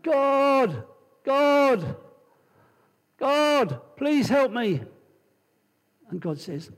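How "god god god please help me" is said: high, loud desperate cries to God; crying out. Acted. Man hanging from a branch cries loudly to God for help. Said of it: "And he starts crying out".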